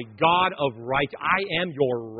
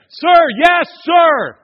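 much higher and louder military bark; soldier's shout; 12 semitones higher, 13 dB louder, much slower than usual. Retold or imitated. Soldiers answering an officer, loud clipped shout. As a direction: shout it like a drill response. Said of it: "you saying".

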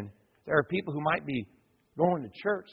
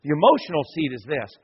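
usual speaking voice

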